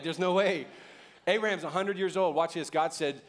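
ordinary talk

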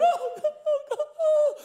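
high, loud hammed-up wailing; mock crying. Imitating the family crying at the host's words, mock wailing. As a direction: pretend to bawl with overwhelmed joy. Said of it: "they're crying".

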